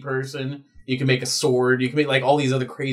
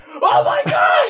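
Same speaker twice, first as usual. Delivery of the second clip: high, loud, slow cry of dismay; shocked cry; 6 dB louder, much slower than usual. Retold or imitated. Finn reacting to the news, high drawn-out cry. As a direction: wail it out in shock. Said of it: "And he's like".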